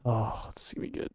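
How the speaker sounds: hushed, gleeful aside; whispering. Real, not acted. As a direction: whisper it to the audience. Gleeful aside before confrontation.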